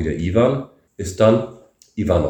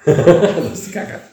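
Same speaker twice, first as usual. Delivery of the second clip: laughing; 9 semitones higher, 4 dB louder than usual. Real, not acted. Laughs at funny word Kaka.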